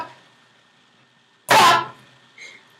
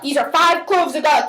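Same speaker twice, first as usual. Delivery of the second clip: ordinary delivery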